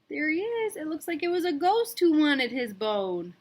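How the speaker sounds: usual speaking voice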